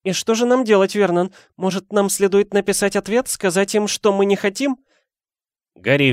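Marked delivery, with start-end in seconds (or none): 0.0-4.8 high, worried pleading questions; Aunt Petunia trembling. A narrator's reading. Wife asking husband what to do, high and anxious. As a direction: seek guidance, voice pitched up anxiously. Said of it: "дрожащим голосом".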